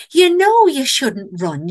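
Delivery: high, loud, slow Northern English scolding. A narrator's reading. Bear picking up the fleeing camper, Northern English chiding. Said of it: "it had a Northern English accent".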